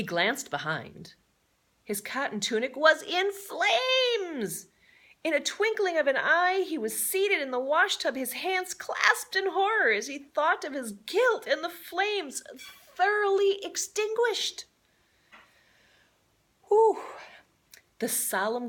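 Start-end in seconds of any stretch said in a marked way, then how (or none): 1.8-14.7 high, loud breathless storytelling; dramatic excitement. A narrator's reading. Narrator telling the fire scene, high and excited. 16.7-17.0 high loud whew of relief; loud relieved whew. A narrator's reading. Narrator relieved the fire is out, loud whew.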